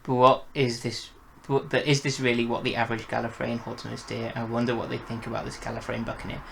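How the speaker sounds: ordinary delivery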